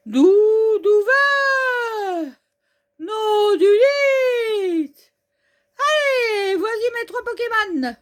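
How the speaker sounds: high, slow, loud stretched-out calls; excited trainer. Acted. Mountaineer hyped for his first trainer battle, calling out Pokémon. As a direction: shout each Pokémon's name with glee.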